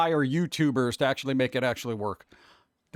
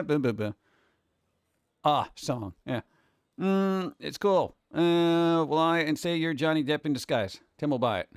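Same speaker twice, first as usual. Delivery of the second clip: usual speaking voice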